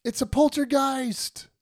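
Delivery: alarmed protest. Retold or imitated. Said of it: "How come no one's trying to stop him?".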